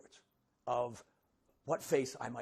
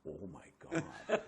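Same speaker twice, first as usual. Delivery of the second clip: stunned realization; 5 semitones lower, much slower than usual. Retold or imitated. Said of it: "And I went".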